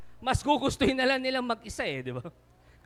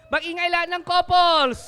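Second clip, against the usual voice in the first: calling out; 6 semitones higher, 5 dB louder, faster than usual. Real, not acted. Rallying couples to cheer.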